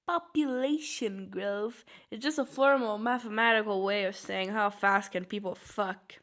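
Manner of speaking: ordinary talk, nothing marked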